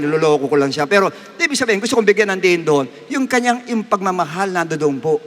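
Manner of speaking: ordinary delivery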